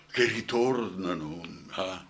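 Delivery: ordinary talk, nothing marked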